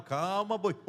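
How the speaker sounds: alto, rindo, acalmando um boi; acalmando o boi brabo. Retold or imitated. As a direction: ria e peça calma ao boi. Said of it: "parece o boi brabo". (esposa brinca com o marido nervoso, alto e rindo)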